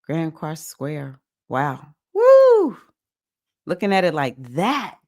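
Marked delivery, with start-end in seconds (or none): 2.1-2.8 high, loud whoop of delight; excited whoop. Real, not acted. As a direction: let out a big thrilled woo. Excited reaction to chart aspect.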